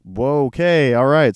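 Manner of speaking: stunned, overwhelmed acceptance; overwhelmed surprise. Retold or imitated. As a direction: react as if handed far too much. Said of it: "And we're like".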